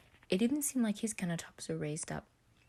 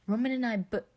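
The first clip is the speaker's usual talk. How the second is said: a burst of higher, louder excitement; excited start cut off; eager outburst; 7 semitones higher, 8 dB louder than usual. Acted. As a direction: blurt it out eagerly. Patton starts, voiced excitedly.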